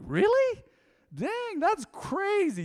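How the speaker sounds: squeaky high, drawn-out fake amazement; hammed-up high surprised voice; mock surprise. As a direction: play up the disbelief, pitch way up. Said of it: "I'm like".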